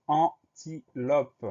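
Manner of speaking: "antilope" drawn out slowly in three separate beats; syllable by syllable. Shown as a demonstration. Teacher introducing a spelling word, "antilope" slowly syllable by syllable. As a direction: break "antilope" slowly into an, ti, lope. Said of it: "Deuxième syllabe".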